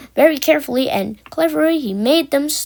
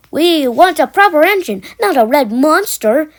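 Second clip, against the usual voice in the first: high, loud jeer; jeering trucks; 4 semitones higher, 6 dB louder than usual. A narrator's reading. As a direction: taunt the red engine.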